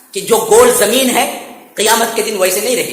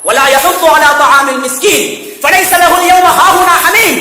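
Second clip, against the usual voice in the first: chanted recitation, melodic and raised; Quranic recitation; 7 semitones higher, 4 dB louder than usual. Acted. Preacher reciting the verse to the congregation, chanted. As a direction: intone the verse with tajweed.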